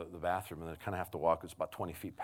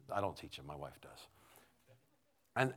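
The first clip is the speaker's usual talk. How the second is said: ordinary talk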